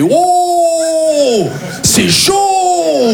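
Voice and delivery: mock alarm. Boss on the phone, hammed-up shocked shout.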